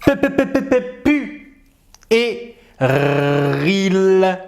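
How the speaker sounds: teacher breaking puéril into its sounds; syllable by syllable. Shown as a demonstration. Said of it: "ce mot-là".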